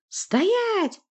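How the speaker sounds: very high squeal; shrieked command. A narrator's reading. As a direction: squeal the order sharply. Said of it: "взвизгнула Власта".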